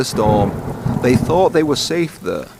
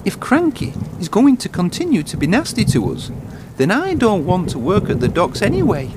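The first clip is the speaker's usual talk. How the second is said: a higher, upset little engine saying his piece; young engine, higher and sulky; Thomas, higher voice; 7 semitones higher than usual. Acted. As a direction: lift the voice for a hurt, fed-up Thomas.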